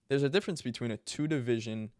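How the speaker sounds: usual speaking voice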